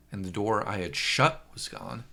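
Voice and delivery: ordinary delivery, nothing marked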